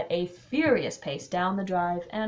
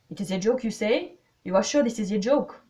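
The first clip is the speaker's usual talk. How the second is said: put-on louder French-accented questioning; Poirot; 4 semitones higher, 8 dB louder than usual. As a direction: probe with rising, doubtful questions. Voices Poirot.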